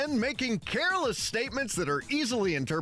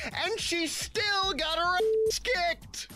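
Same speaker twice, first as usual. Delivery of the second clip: mocking sing-song; 11 semitones higher, much slower than usual. Mocking Hillary's loss.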